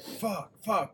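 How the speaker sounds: high-pitched panicked cursing, played lightly; mock panic. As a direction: imitate someone trapped and frantic. Acting out locked-in thieves trying to escape.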